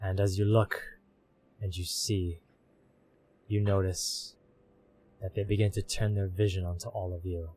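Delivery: ominous narration. A narrator's reading.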